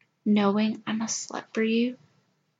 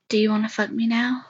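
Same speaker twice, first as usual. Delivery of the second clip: normal talking voice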